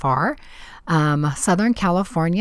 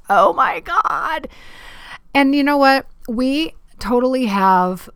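exasperated outburst; 9 semitones higher, 5 dB louder than usual. Real, not acted. Host snapping out of the negative quotes, high excited exclamation. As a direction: burst out, then rally. Breaking off self-talk quotes.

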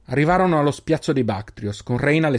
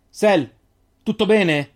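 high, loud call to a friend; 8 semitones higher, 5 dB louder, much slower than usual. A narrator's reading. Reynal calls loudly to Selin. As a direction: call out to her, worried. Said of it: "chiamò Reynal".

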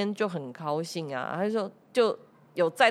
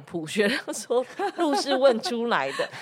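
laughing; 5 semitones higher than usual. Real, not acted. Laughing while quoting a friend.

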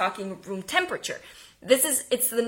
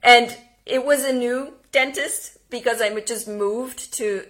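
usual speaking voice

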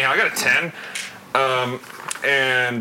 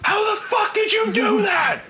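much higher, stunned yell of disbelief; amazed shout. Real, not acted. Amazed at the kill.

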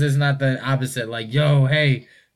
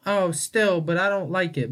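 lightly imitated mom, higher pitch; higher-pitched, hedging mock-mom protest; mom still objecting; 6 semitones higher than usual. Mom holding out against her child's argument, lightly imitated objection.

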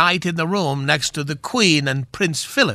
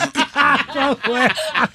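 hearty laugh; 6 semitones higher than usual. Real, not acted. Laughs at own pun.

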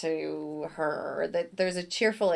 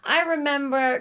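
lightly imitated New York accent, higher and louder; Jessica Walters; 7 semitones higher, 5 dB louder than usual. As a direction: launch an anecdote in a mock New York accent. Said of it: "she's got this heavy New York accent".

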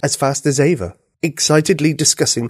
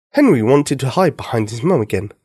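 ordinary delivery

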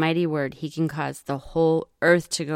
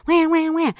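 high mock whining wah wah; whiny wah wah; 10 semitones higher than usual. Teacher mocks the complaining brothers with whiny wahs. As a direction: whine like a sulky kid. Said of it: "His brothers are complaining".

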